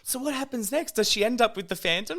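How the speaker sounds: high-pitched imitation of an eager theatregoer; curious audience member. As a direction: mimic someone desperate to know the ending. Imitating anxious audience members behind her.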